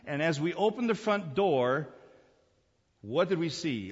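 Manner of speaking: ordinary talk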